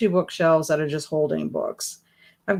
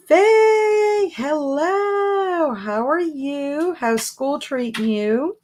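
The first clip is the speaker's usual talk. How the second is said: high, drawn-out singsong hello; singsong greeting; 10 semitones higher, much slower than usual. Real, not acted. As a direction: greet them in a long, high-pitched swoop, easing down. Greeting a viewer joining the chat.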